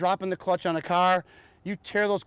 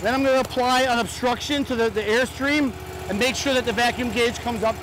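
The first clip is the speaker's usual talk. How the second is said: raised, higher voice over machinery; shouting over engine; 4 semitones higher than usual. Real, not acted. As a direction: project over the running engine. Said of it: "it may be hard for you to hear me".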